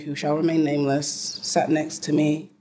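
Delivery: ordinary delivery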